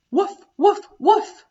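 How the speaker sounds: high, loud playful woofs; cheerful woof woof woof for children; dog barking. Shown as a demonstration. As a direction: bark like a friendly puppy. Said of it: "What animal goes".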